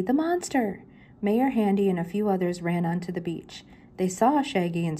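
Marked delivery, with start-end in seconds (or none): 0.0-0.8 high frightened cry; panicked. Acted. Shaggy warning the mayor, high frightened cry. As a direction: cry out in fear.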